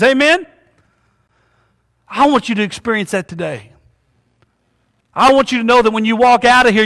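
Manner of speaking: ordinary talk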